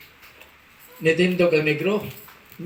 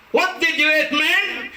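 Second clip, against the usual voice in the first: high put-on American question; mock American asking; 9 semitones higher, slower than usual. American man asking what he ate, high put-on accent. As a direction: quiz him casually, American style.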